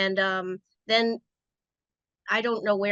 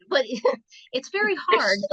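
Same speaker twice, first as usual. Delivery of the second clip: amused admission breaking into laughter; laughing; 4 semitones higher, 3 dB louder than usual. Real, not acted. Former jammer admits the sport is tough, laughing. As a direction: say it through a laugh, pitched up.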